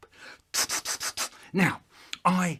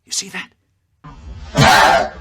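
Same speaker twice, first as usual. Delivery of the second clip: slow, loud, stretched exclamation; slow booming shout; 6 semitones higher, 12 dB louder, much slower than usual. Acted. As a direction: drag it out and shout. Dramatic shout at audience.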